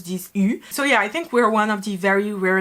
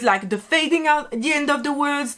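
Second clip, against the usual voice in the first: ordinary talk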